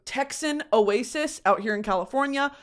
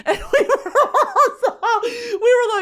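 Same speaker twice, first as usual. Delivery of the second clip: high, laughing squeal; words breaking up in laughter; laughing while talking; 12 semitones higher, 5 dB louder, slower than usual. Real, not acted. Storyteller cracking up over the drunk night, laughing.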